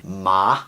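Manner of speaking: "ma" gliding upward; second tone. Shown as a demonstration. Teacher previewing Mandarin tones, rising second-tone "ma". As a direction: let "ma" climb in pitch like a question. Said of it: "goes up".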